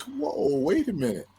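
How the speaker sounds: high-pitched retold "whoa", then dropping; upset disbelief. Athlete seeing his name wrong on the mail, upset disbelief. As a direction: balk in mock shock, starting high. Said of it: "That really upset me".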